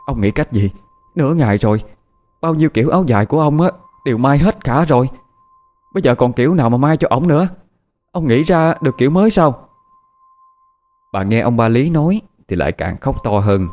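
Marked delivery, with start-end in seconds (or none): 0.1-9.7 higher, fast, pressing questions; agitated wife. Acted. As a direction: fire the questions at him, rising higher and faster.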